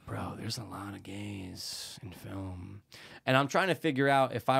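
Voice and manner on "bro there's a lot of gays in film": slow whisper; hushed pondering. Pretend. Co-host pondering his number two, slow whisper. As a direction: whisper it slowly, as if confiding.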